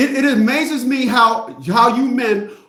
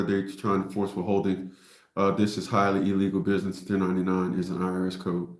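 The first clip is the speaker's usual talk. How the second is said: low, quiet monotone read; reading aloud; 11 semitones lower, 5 dB quieter than usual. Real, not acted. Reading a viewer's question about withholding, low monotone. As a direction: read it out low and even, unperformed.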